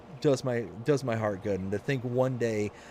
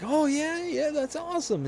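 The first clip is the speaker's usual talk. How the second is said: high mock excitement. Retold man's excitement.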